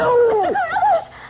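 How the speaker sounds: a shrill, otherworldly high laugh; an unseen presence laughing over the struggle, high and eerie. Acted. Ghostly high-pitched laugh.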